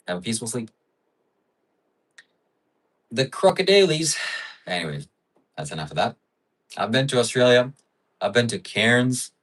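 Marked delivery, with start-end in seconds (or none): 3.1-4.2 high mock-Australian accent; Aussie accent. Streamer returns to the requested bit, high mock Aussie accent. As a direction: toss out one more hammed-up Aussie phrase. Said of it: "Give us your best Aussie accent".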